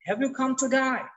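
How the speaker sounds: British-style today, "to die" for "today"; British pronunciation. Acted. Foreigner asking a colleague if he came today, British pronunciation. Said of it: "এখন আসলে ও বলছে যে".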